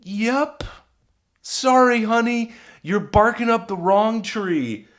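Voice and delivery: high, singsong, sassy apology; playful high-pitched brush-off; mock-sympathetic letdown.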